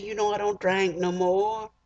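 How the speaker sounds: high, whiny, hurt friend's voice; T-Boy sulking. Retold or imitated. T-Boy complaining to his drinking friends, high and whiny. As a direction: whine it up high like a wounded buddy.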